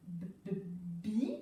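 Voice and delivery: «b, b, bi» built up slowly sound by sound. Shown as a demonstration. Teacher reading along with pupil, slow «b, b, bi». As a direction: stretch out «b, b, bi» slowly. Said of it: "ça va faire".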